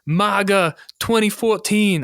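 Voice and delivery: high-pitched, hammed-up British soldier accent; bad British accent. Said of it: "I can't even do a fucking British accent".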